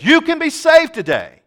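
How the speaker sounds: sudden high, loud cry of the altar call; preaching shout. Real, not acted. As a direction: lift it up and shout the promise. Preacher's climactic appeal.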